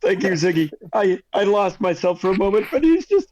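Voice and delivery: a barbarian losing it over his dead father figure; hammed-up crying. Barbarian thanks Ziggy, hammed-up voice breaking. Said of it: "He's crying now".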